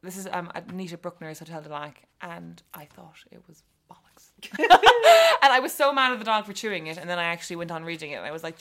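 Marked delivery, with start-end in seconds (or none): 2.2-4.3 hushed, near-whispered verdict; confiding undertone. Real, not acted. Confiding a low opinion of book. 4.4-5.4 loud, high-pitched laugh; burst of laughter. Real, not acted. Laughing at own rude verdict on a novel, burst of laughter.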